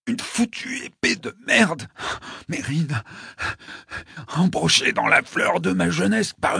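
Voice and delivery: high, hoarse, gasping rant; panting in pain. A narrator's reading. Wounded Mike cursing the sword, panting in agony. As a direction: curse between gasps, rising to a pained cry. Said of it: "haleta-t-il".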